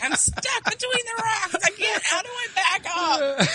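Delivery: very high, helpless squeal; hammed-up panic from a lost player; mock panic. Acts out panicked gamer.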